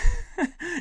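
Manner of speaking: high burst of laughter; laughing. Real, not acted. Comic amused by own accusation, laughing. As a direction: crack up laughing.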